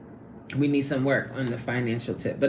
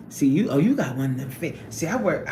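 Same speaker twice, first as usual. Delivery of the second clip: normal talking voice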